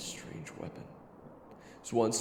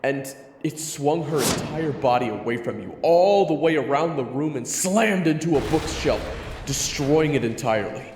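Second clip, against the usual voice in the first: loud, higher, forceful storytelling; excited loud narration; 5 semitones higher, 12 dB louder than usual. A narrator's reading. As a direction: build the impact at full force. Narrator at climax of rescue.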